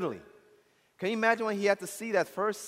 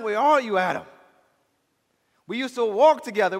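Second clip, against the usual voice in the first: loud, raised voice calling out as God; impassioned preaching; 5 semitones higher, 7 dB louder than usual. Acted. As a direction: preach it forcefully, pleading with Adam.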